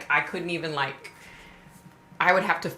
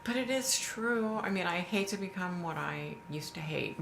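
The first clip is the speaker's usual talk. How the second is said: normal talking voice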